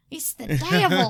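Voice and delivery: loud, hammed-up movie mother; mom on Waterboy. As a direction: cry it out like an alarmed mother. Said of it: "like the mom on Waterboy".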